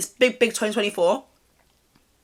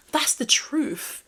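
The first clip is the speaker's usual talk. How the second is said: slow, higher-pitched whisper; 6 semitones higher, much slower than usual. Real, not acted. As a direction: lean in and whisper it as a secret. Confiding aside to viewers.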